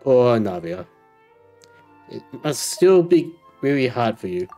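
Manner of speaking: usual speaking voice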